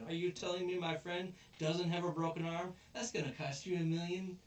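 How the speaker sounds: high, loud mock indignation; hammed-up accusing demand; mock outrage. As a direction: play a thug shaking someone down. Acted thug demanding money.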